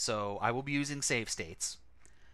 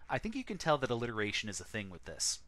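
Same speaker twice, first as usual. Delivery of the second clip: usual speaking voice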